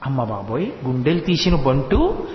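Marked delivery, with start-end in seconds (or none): 0.1-0.7 mild mock surprise. [name]'s shocked exclamation.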